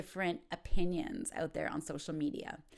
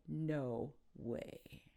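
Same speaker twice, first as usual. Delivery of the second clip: doubting no way; 6 semitones lower, 9 dB quieter than usual. Shown as a demonstration. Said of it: "if you think your friend might be joking".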